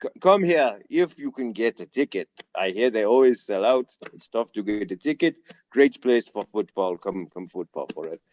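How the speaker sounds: imitated German-accented coach; Jurgen Klopp. Reporter imitates coach cutting him off, in mock German accent. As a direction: put on a German coach's voice, dropping away at the end. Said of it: "and he's like".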